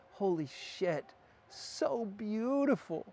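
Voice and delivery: high, drawn-out wonder; reliving awe, voice lifted and slow; awestruck. Retold or imitated. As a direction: stretch it out slowly in amazement. Retelling his awe at the couple.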